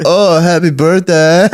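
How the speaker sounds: slow, high, through a laugh; a laughing Wiseau line. Retold or imitated. Playing Wiseau.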